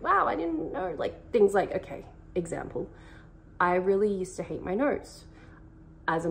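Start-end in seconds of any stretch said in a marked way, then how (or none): 0.0-0.9 high, amazed little gasp of discovery; pleasantly surprised. Retold or imitated. Learning her husband loves what she hated, amazed and high. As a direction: sound delighted by a discovery. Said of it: "And I was like".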